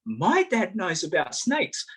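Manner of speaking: put-on high, slow little-girl voice. A little girl tells the walker proudly in a high, slow voice. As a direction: say it proudly and slowly like a small girl. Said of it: "a little girl".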